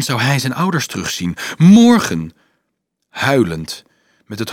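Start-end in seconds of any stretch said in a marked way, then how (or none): 1.6-2.3 high, loud exclamation of Morgen!; Morgen! cried out high and loud; joyful cry. Acted. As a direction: burst out Morgen! with joy. Joyful outburst about tomorrow.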